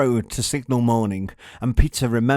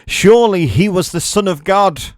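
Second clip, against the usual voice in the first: louder, higher awed cry; centurion crying out; 8 semitones higher, 10 dB louder than usual. A narrator's reading. Quaking centurion at the cross, crying out in awe. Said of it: "cried out".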